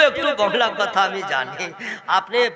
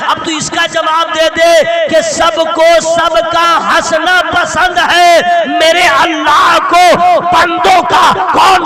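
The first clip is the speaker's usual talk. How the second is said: loud, rising sermon voice, hammering each line; preacher pleading with the crowd at full force; fiery preaching; 5 semitones higher, 9 dB louder than usual. Acted. A preacher thunders to the gathering about crying.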